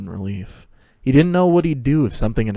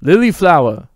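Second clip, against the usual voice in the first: higher and louder teasing greeting; excited call; 8 semitones higher, 8 dB louder than usual. A narrator's reading. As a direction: sing out the nickname. Sirius greets Lily teasingly.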